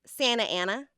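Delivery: "Santa" said as "Sanna"; Californian dropped T. Retold or imitated. Friend naming her town, Californian "Santa Ana" without the T. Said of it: "That's a Californian accent".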